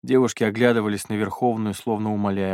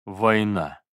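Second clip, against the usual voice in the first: dry. Acted. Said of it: "сухо отозвался Готье".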